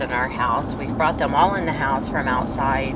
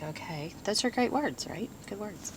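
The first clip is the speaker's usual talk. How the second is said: hushed mock approval; 5 semitones higher, 11 dB quieter than usual. Mock-approving husband's words.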